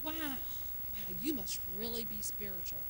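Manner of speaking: hushed, very soft admiration; mock awe. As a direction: breathe it in exaggerated reverence. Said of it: "it sounded so".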